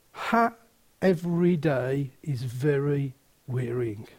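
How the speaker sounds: low, soft, pastoral sincerity; hushed, solemn encouragement with pauses; tender reassurance. Real, not acted. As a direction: comfort them gently, voice dropped. Consoles listeners who face scorn.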